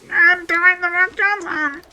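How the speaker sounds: very high, squeaky, deliberately awful celebrity voice; bad Dwayne Johnson impression. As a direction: butcher the impression on purpose. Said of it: "world's worst impression of Dwayne The Rock Johnson".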